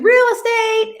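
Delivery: high call into the house; a realtor calling out in the kitchen. Retold or imitated. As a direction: call out to announce yourself.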